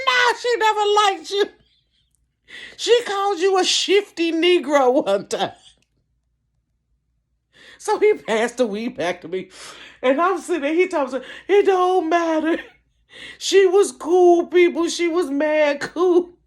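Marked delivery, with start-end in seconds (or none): 0.1-5.5 high-pitched, wailing insistence; insistent contradiction. Retold or imitated. Insisting to friend mama never liked him, worked up. Retold argument with crying friend. 11.5-16.4 mock crying. Friend defending her mama's memory, crying. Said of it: "we're sitting here crying".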